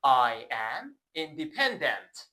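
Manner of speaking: "I am independent" drawn out slowly; slow model sentence. Shown as a demonstration. Teacher slowly models "I am independent" for repeating. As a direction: model it word by word. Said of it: "با من تکرار کنی".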